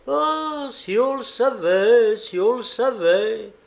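high, loud, drawn-out teasing refrain; chœur de nains. A narrator's reading. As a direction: chant it high like mocking dwarfs. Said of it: "reprirent en chœur ses compagnons". (refrain moqueur, aigu et traînant)

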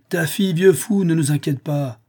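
loud, high, impatient outburst; scornful rebuke. A narrator's reading. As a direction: cut the old fool off. Centurion scolds the old man.

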